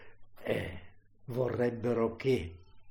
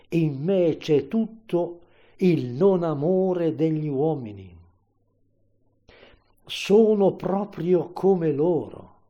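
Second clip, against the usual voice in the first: loud, raised, impassioned declamation; anguished outcry; 4 semitones higher, 8 dB louder than usual. Acted. Preacher as crucified Jesus crying to God, loud and anguished. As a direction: cry out to an absent God in big, separated phrases.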